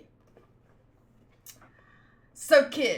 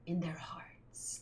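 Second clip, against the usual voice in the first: softly whispered ending; 5 semitones lower, 12 dB quieter, slower than usual. Acted. Narrator closing on the people's hearts, whispered. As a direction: breathe the words out in a whisper.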